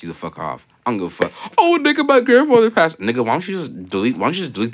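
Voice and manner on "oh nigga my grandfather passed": high, whiny mocking quote. Mocking a friend's grief post in a whiny voice. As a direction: whine it like a fake sob post.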